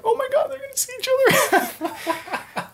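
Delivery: very high, fast, breathless gush; excited squeal. Retold or imitated. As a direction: squeal like a fan at the big moment. Viewer's excited reaction in movie.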